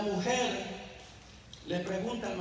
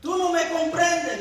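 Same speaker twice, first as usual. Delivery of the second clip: much higher, louder, slow yell; shouting wife; 10 semitones higher, 10 dB louder than usual. Retold or imitated. Wife shouts at her husband, much higher, louder and slow. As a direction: hurl it at him, hurt and loud. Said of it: "le gritó ella".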